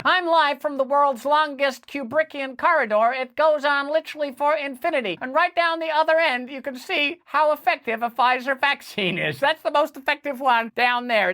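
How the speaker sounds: lightly hammed-up live reporter voice; mock field reporter. Host playing a correspondent live from an endless corridor, lightly hammed-up.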